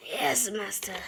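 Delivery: unvoiced servile reply; whispered. A narrator's reading. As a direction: breathe the answer out. Elf answers, whispered.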